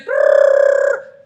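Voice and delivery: loud trilled school-bell ring; mimicked ringing noise; imitated bell. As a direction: roll a long, high trill like a bell. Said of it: "begitu bunyi bel".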